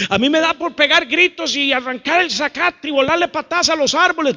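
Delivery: high, frantic mock venting; high-pitched comic agitation; worked-up congregant. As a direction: act someone itching to scream and kick. Acts congregant wild to scream, kick.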